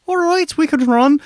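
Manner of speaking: high, loud flustered voice; panicked Tedby voice. Acted. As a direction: babble in a nervous panic. Tedby voice again.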